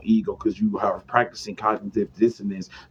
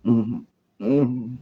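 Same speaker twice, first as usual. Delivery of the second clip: 1 changed voice. Retold or imitated. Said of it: "The voice would change and everything".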